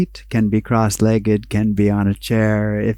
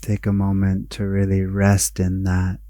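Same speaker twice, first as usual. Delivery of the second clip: guided meditation; 6 dB quieter than usual. Acted. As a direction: guide them inward, unhurried, letting each phrase settle.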